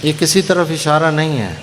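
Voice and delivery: ordinary delivery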